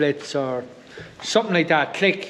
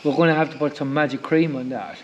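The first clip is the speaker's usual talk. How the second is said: softer, tender comforting; 3 dB quieter than usual. Real, not acted. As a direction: hush her kindly. Comforting hurt daughter.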